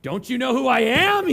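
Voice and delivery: loud, hammed-up indignant demand; a mock angry yell at the bank teller; mock furious outburst. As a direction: blast it out like a wounded ego. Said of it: "I'm just, like, furious".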